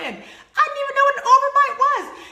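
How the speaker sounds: very high, loud laughing repeat; laughing shriek. Retold or imitated. Repeating her line to the audience, high laughing squeal.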